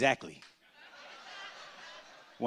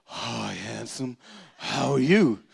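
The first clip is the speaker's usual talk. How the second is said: flirty greeting; 4 semitones lower, much slower than usual. Retold or imitated. Large lady flirting with the comedian, slow and low. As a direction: purr a slow, heavy hello. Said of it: "She was like".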